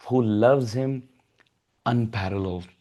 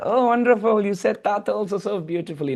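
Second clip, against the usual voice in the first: much higher, quick cooing delight; gushing praise; 7 semitones higher than usual. Acted. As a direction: fuss over the baby. Mothers praise baby's word.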